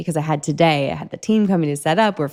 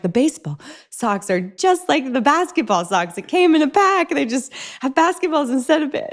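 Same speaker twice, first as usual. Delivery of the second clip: pleading reasoning; 8 semitones higher than usual. Retold or imitated. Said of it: "I'm like".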